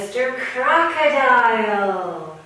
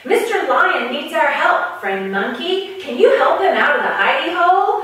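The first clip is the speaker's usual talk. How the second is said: higher, louder bright-eyed rabbit voice; a small animal pleading, pitched up and loud; eager rabbit; 9 semitones higher, 7 dB louder than usual. Acted. Rabbit asks monkey for help.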